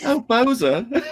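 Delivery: high swooning Southern belle. Pretend. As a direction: breathe his name high and longing. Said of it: "I like the way you say my name like that".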